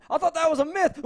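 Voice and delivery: high, loud panicked cry; mock shout. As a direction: yell it like a guard about to be mauled. Imagined villain attacked by tiger.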